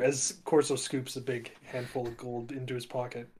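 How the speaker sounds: ordinary talk, nothing marked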